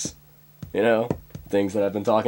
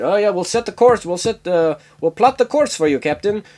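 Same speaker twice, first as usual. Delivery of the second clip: higher eager crewman voice; mock eager crewman; 7 semitones higher than usual. Sulu eagerly answers the captain, mock eager. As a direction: play the keen subordinate, a bit hammed-up. Mocking crew doing shit work.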